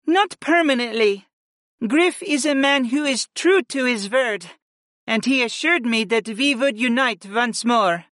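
high, earnest princess voice; confident young woman. Acted. Freya reassures mother.

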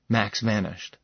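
ordinary delivery, nothing marked

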